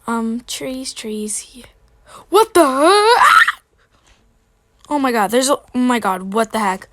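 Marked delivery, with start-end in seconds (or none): 2.3-3.6 sudden high shriek of surprise; startled scream. Real, not acted. Startled by another player. 4.9-6.9 loud alarmed oh my god; loud and rattled by a fright; shocked exclaiming. Real, not acted. Shocked at intruder.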